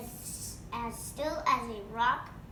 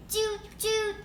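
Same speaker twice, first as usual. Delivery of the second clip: high loud toot toot like a trumpet; 10 semitones higher, 5 dB louder than usual. Acted. Bean plays his trumpet.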